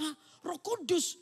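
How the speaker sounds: disbelieving outburst; incredulous. Acted. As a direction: Joseph mocking the Holy Spirit answer. Joseph baffled by answer.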